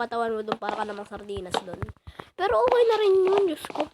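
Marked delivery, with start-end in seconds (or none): 2.3-3.9 high put-on voice. High squeaky aside.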